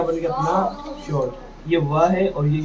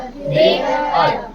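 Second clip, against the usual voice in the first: "दे अर" stretched very slowly and higher; slow sounded out; 9 semitones higher, much slower than usual. Shown as a demonstration. Teacher stretches "दे अर" slowly so pupils hear the अर. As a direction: draw "दे अर" out slowly, sounds apart. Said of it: "क्या बनेगा?".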